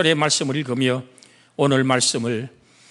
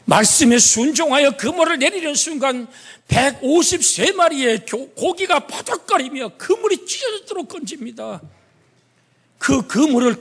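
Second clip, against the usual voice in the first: preaching; 10 semitones higher than usual. Acted. Preacher building the miracle.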